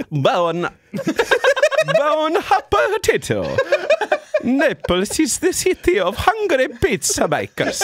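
high, slow hammed-up Italian accent; big cartoon Italian, drawn out. Shown as a demonstration. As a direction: lay on a theatrical Italian accent. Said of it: "can you do an Italian accent?".

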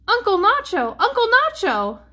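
high, loud storybook call. A narrator's reading. Niece at Uncle Nacho's door, calling out. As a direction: call for someone from the doorway.

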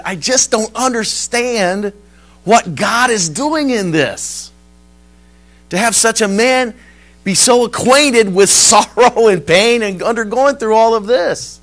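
loud, high, pained questioning; anguished bewilderment. Retold or imitated. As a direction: plead to understand, voice lifted. Retells hospital woman's anguished protest.

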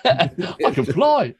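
drawn-out, laughing; lachend, gedehnt; laughing, slow mock boast. Playing the stuck pilot.

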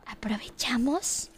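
hushed plotting whisper, playful; conspiratorial whisper. Acted. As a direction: whisper the secret plan. Plotting secret toilet sabotage.

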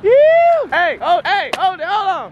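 alarmed high cry; startled shout. Real, not acted. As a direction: jolt up in alarm. Something falls, alarm.

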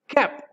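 "cap" cut very short, pitched high; short and clipped. Shown as a demonstration. Teacher shows the nurse's headwear word, "cap" kept short. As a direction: say "cap" as one quick high syllable. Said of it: "Cortito, ¿cierto?".

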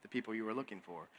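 a mock Jedi mind trick, low and hushed; Jedi Master. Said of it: "he goes all Jedi Master".

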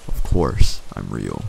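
low monster voice, firmly insisting; deep crackly monster. A narrator's reading. Monster insists to the child it is real, deep and crackly. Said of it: "deep and crackly".

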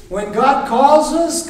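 usual speaking voice

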